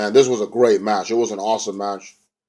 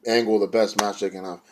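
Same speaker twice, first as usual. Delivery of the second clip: ordinary delivery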